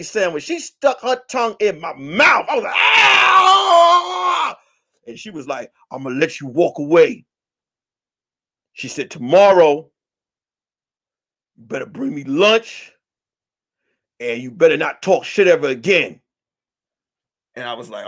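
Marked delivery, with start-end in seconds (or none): 2.7-4.6 long, high, loud "ah"; comic scream of being grossed out; mock horrified scream. Kissed with a peanut butter tongue, mock horrified scream. 5.9-16.2 low-pitched threat with long pauses; imitated stern warning. Donna letting him walk away, imitated low warning.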